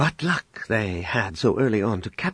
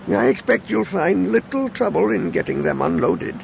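put-on old man voice; 7 semitones higher than usual.

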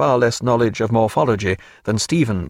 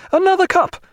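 high, raised order to a servant; imperious call; 4 dB louder than usual. Acted. Lady Forbes calling for her servant, imperious and high. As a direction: call out for service, pitched high.

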